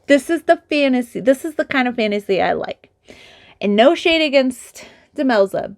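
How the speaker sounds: ordinary talk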